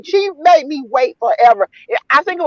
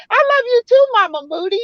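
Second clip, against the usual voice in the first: sweet sisterly reply; 13 semitones higher, faster than usual. Retold or imitated. Sister's loving reply, retold.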